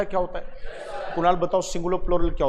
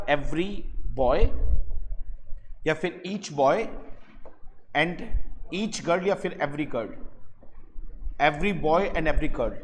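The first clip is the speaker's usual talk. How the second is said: slow model sentence; 2 semitones lower, 5 dB quieter, much slower than usual. Shown as a demonstration. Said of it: "तो सही sentence कैसे लिखा जाएगा".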